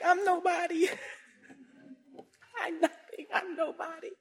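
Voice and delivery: high, shrinking reply; small timid voice. Retold or imitated. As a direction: make yourself tiny before him. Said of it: "now I'm like".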